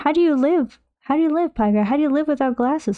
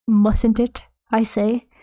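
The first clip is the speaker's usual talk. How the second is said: ordinary talk, nothing marked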